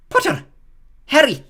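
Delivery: loud, high name call. Acted. McGonagall calls a pupil forward, loud and high. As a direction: call the name across the hall. Reading names to the hall.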